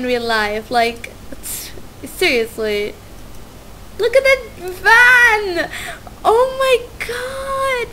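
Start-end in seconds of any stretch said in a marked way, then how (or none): 4.0-7.9 high, slow gushing squeal; delighted squeal. Real, not acted. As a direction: melt over how cute it is. Gushing over game visuals.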